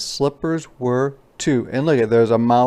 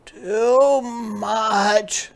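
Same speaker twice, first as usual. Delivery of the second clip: cub voice, much higher, whiny; complaining little cub; 11 semitones higher than usual. Acted. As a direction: complain like a small child. Sam complaining.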